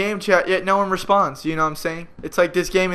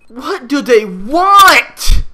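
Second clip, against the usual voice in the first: slow, loud, high wail of frustration; exasperated cry; 7 semitones higher, 7 dB louder, much slower than usual. Real, not acted. Frustrated with confusing game.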